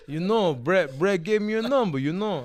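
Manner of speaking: loud Rocky-style impression; emphatic movie-boxer voice; Sylvester Stallone. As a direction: imitate the action star explaining over the phone. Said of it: "Make sure you do his voice".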